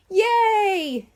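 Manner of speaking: high, loud cheer of delight; happy yell. A narrator's reading. Boy cheering at the offer of ice cream, loud happy yell. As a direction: shout it out like a thrilled kid. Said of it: "Nate yelled".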